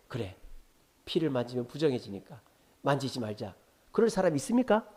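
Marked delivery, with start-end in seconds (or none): 0.1-3.6 low, soft talking to oneself; acted giving up, low and subdued; quiet resignation. Acts a bleeding person's passive resignation.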